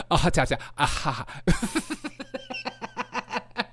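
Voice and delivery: mock stammering syllables, the last one jumping up high; imitating a stammer. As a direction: stutter it like a bumbling speech. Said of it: "when he goes".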